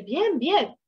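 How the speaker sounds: quick automatic reply in a light put-on voice; a hint of a casual acquaintance; brisk reply. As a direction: toss off the answer without thinking. Typical quick answer to "¿Cómo está?.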